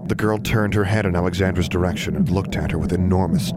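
ordinary delivery, nothing marked

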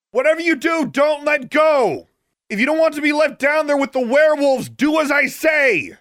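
very high, sustained shouted orders; yelled. A narrator's reading. Heroine instructs girl below about the rope, yelling. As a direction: shout each order to carry far, stretching the endings. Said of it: "Alexandra yelled".

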